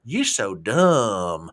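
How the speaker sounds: very slow, deadpan; blunt Southern. Retold or imitated. Translating the Southern put-down, slow and blunt. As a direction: spell out the insult slowly. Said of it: "that's the Southern way of saying".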